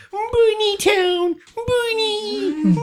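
put-on high, slow baby-talk Bunny; cutesy bunny voice. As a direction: coo Bunny Town way up high like to a child. Cute correction Rabbit to Bunny.

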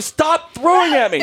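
high-pitched mock yell; retold yelling. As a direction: act out yelling at a kid, voice shooting up. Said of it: "like, yelling".

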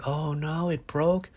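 soothing echo. Shown as a demonstration. Parent echoing toddler's broken Lego, tender. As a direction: mirror his words back gently. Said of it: "soothing energy in my voice".

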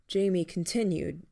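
ordinary delivery, nothing marked

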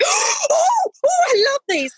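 very high, breathless cry of delight; delighted gasp. Retold or imitated. Manager seeing the balloon columns, squealing high with delight. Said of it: "she's like gasps".

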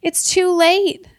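loud, high, slow wail. Acted. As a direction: wail it in despair. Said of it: "wailed George".

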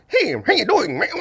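high put-on comedian's voice; exaggerated high-pitched friendly greeting.

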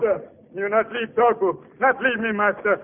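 simple giant's anxious plea; pleading slave. Acted. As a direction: beg your master not to abandon you. Slave begs master not to leave.

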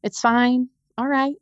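a hint of her calm husband saying it's fine; mock-calm husband reassuring her on the way out; imitating calm husband. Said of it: "he was so calm".

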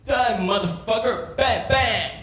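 high-pitched mock gangster; mock gangbanger threat. American gangbanger drawing a gun, mock threat. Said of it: "they draw their guns and say".

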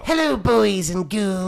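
high, slow cackling ghoul host voice; Crypt Keeper voice. Pretend. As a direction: go full creepy horror host, drawn out. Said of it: "You know you wanna do the voice".

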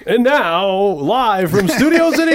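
slow, loud game show announcer. Pretend. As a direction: build it up like live TV. Mock live show announcement.

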